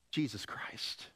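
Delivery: hushed whisper. Real, not acted. Preacher whispers the name of Jesus to the church. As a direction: breathe the name reverently.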